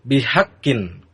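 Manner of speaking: ordinary delivery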